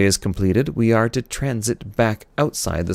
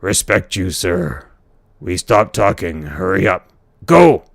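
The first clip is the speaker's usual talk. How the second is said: growly alien voice; 6 dB louder than usual. Acted. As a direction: growly voice ending in a shout. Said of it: "growly voice".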